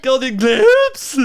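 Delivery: "Golden Globes" mimicked slow, high and loud; mocking imitation. Mocking a friend's bad accent, slow, high and loud. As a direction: parrot the mangled words back, slowly. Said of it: "What the fuck are you doing".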